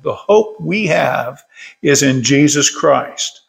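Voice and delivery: ordinary talk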